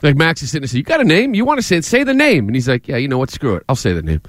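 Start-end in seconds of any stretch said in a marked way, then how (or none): 0.8-2.5 higher-pitched, insistent prodding; goading questioner. Retold or imitated. Host acts out Max goading player. 2.9-4.2 low voice, sinking lower; grudging giving-in. Retold or imitated. Acts out player's giving in.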